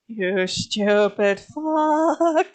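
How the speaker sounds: very slow, dragged-out exasperated insult; muttered insult. Retold or imitated. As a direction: stretch every word in disbelief. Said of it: "Ava in the corner going".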